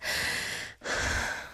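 long voiceless breaths out; demonstrated heavy breathing. Shown as a demonstration. Host showing what she does off-mic, heavy breathing. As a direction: let the air out in big, audible puffs. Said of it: "I would go like".

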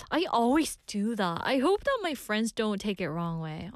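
ordinary delivery

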